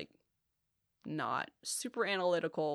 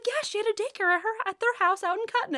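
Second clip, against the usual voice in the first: much higher, quicker mom voice; voicing her mom; 5 dB louder than usual. Mom recalls the old daycare, high quick voice. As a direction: recite it like mom remembers everything.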